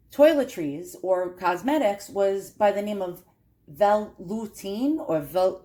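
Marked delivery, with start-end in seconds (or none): 3.7-5.6 Veloutine sounded out slowly, unsure of the French; careful French attempt. Shown as a demonstration. Collector unsure of a French name, slow careful try. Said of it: "Don't know if I'm pronouncing it right".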